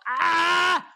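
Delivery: sudden yelp of pain. Retold or imitated. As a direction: yelp as it hits the face. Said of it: "I go".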